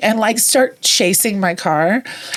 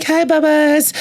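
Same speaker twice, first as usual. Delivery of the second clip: put-on baby talk to dog; 9 semitones higher, slower than usual. Greeting rescued dog.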